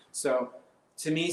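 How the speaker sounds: usual speaking voice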